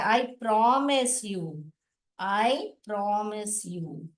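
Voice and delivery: slow, measured promise; slow reading aloud. Acted. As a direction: draw out the promise for the class. Reads the quote slowly.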